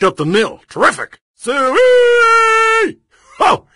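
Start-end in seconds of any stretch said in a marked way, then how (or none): 1.4-3.7 high, loud hog-calling holler; Suey!. Acted. Bidder whooping like calling the hogs, loud hog call.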